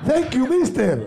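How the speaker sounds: slow, laughing fake American; mock American English. Mocking the fake foreign band, slow American accent. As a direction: ham up a gringo thank-you.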